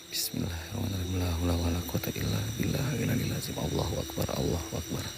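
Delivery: very soft, low murmured prayer; hushed frightened prayer; whispered prayer. Real, not acted. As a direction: pray under your breath for protection. Whispered protective prayer when scared.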